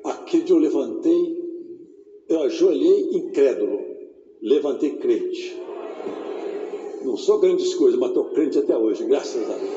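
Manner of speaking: hushed pulpit confession; low solemn testimony. Acted. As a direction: drop low and speak it gravely.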